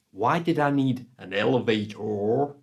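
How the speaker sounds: a hint of an American accent on the hotel clerk's question; lightly put-on American accent, puzzled question. Hotel receptionist puzzled by lift, light American accent. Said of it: "that's my American accent".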